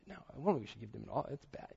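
fast, hushed and low mock gossip; trashing him. Repeating the gossip to the next person, hushed and hurried. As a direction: imitate spreading complaints under your breath. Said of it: "to person to person".

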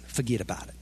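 low, soft New Jersey "Forget about it"; understated New Jersey "Forget about it"; New Jersey accent. Shown as a demonstration. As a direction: drop into a mild New Jersey tough guy. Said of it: "You just need a New Jersey accent".

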